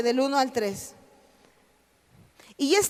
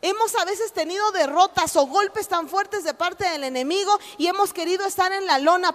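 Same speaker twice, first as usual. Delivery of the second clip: normal talking voice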